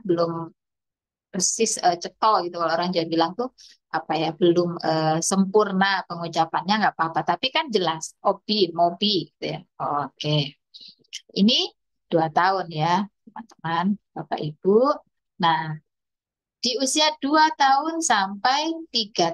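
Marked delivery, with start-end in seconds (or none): none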